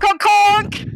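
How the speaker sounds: high, loud clown honking; silly clown honk. Pretend. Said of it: "I'm just gonna do a good old honk".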